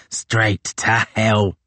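very slow, gloating echo; savoured repeat. Acted. Tozer boasting to Crozier, very slow gloating echo. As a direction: linger on it with relish.

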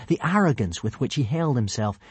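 ordinary delivery, nothing marked

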